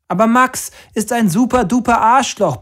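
high, loud angry yell; furious bellow. A narrator's reading. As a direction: roar it with all your might. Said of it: "brüllte ich aus Leibeskräften".

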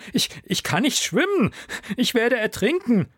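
frightened pleading; afraid warning. Acted. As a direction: warn she cannot swim. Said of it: "warnte ich Leben".

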